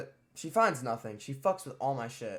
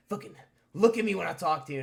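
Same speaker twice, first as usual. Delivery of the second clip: louder, higher stern yell; angry principal; 6 semitones higher, 8 dB louder, faster than usual. Retold or imitated. Principal yelling at a silent student, stern and loud. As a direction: snap at him to look up.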